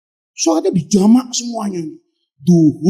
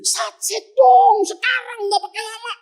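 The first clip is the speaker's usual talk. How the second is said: high-pitched, pushy angel hurrying the Prophet; hurried Jibril; 11 semitones higher than usual. Retold or imitated. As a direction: press him to move now, voice raised high. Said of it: "Karena Jibril ini perintahnya buru-buru". (Jibril mendesak dengan suara tinggi)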